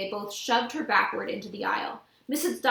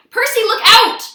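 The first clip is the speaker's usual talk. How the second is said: high, loud warning shout; cried out; 12 semitones higher, 15 dB louder than usual. A narrator's reading. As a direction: yell a warning to Percy. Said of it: "Annabeth cried".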